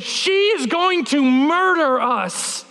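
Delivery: hammed-up slow doom; dramatic dread. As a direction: draw it out like a doomed kid warning mom. Child protests in dread to mom.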